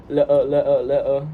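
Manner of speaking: Cockney glottal "Le-uh", t dropped from letter; Cockney warm-up. Shown as a demonstration. Actor warming up for his accent, Cockney glottal stops. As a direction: repeat "Le-uh" as a Cockney accent drill. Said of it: "start with a little warm-up".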